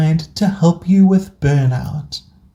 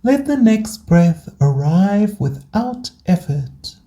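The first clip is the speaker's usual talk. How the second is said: guided meditation. Acted. Host guiding burnt-out listeners into rest, calm meditation voice. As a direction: guide them gently through each breath, unhurried.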